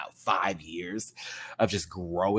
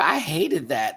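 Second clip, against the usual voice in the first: usual speaking voice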